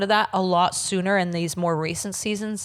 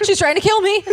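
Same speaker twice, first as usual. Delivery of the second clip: high, louder frantic cry; mock hysterical cry; 12 semitones higher, 6 dB louder than usual. [name] claiming she's in danger, high mock panic.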